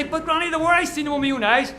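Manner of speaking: loud, high-pitched insistence; child's protest. Acted. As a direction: protest like a child who isn't believed. Child protests to disbelieving granny.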